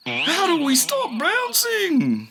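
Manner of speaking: very high, slow, stretched call. A narrator's reading. Anki shouting to friends while bouncing, high and drawn out. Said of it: "shouts Anki".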